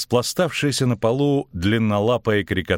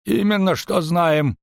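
slow, high-pitched confirmation; innkeeper's raised, deliberate tone; vindictive innkeeper; 10 semitones higher, slower than usual. A narrator's reading. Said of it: "кивнул трактирщик".

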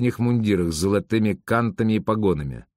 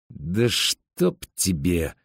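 slow, low curse through the teeth; through gritted teeth; 4 semitones lower, much slower than usual. A narrator's reading. Stepan left behind by his brother, cursing through gritted teeth. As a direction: squeeze the curse out very slowly. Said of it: "процедил Степан".

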